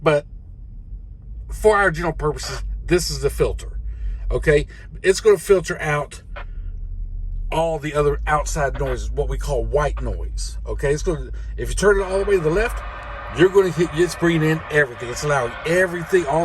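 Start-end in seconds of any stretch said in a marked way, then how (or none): none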